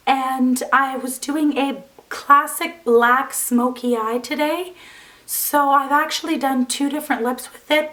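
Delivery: hoarse, strained, barely-there voice; pushing through a lost voice. Real, not acted. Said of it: "I've lost my voice".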